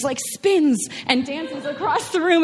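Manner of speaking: usual speaking voice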